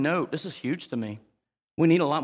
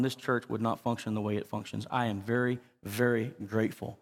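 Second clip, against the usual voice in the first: normal talking voice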